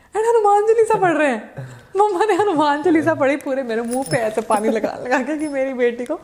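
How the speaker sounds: loud, high voice breaking into laughter; laughing retelling. Real, not acted. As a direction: tell it through real laughs, highly amused. Laughing while retelling mother's drama.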